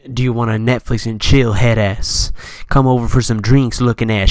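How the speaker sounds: lightly voiced sleazy owner; mocking the owner. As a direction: sneer at the owner's messages. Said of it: "Snapchats coming from the owner".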